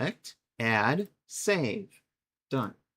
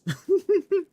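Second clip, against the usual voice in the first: high squeaky giggle; 6 dB louder than usual. Real, not acted. Laughs after savouring.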